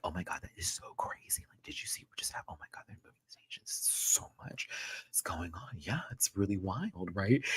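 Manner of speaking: fast hushed gossip between castmates; gossipy whisper. Retold or imitated. Castmates whispering about the drama in a corner, hushed. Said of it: "discussing it in this small little corner".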